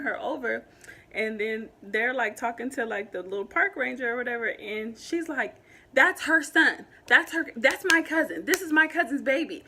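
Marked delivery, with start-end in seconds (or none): 5.9-9.6 raised, louder cousin defending her; a hint of the cousin's anger, higher and louder; hyped-up, mad. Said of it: "getting hyped and she, like, mad".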